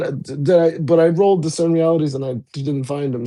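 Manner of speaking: ordinary talk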